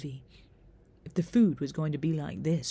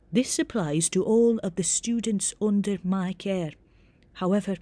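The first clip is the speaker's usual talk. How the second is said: firm Scottish head of house, higher and louder; reedy Scottish accent; 4 semitones higher, 6 dB louder than usual. A narrator's reading. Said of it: "in a reedy Scottish accent".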